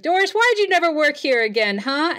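high, loud, pestering question; calling down. Acted. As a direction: holler down to an old friend from a ladder. Ginger calls down from the ladder.

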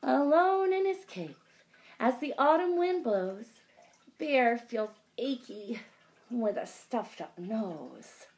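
lively, sing-song picture book read; reading to children. A narrator's reading. Teacher reading aloud to kids, lively sing-song. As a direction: draw the little ones into the story.

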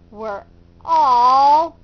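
ordinary delivery, nothing marked

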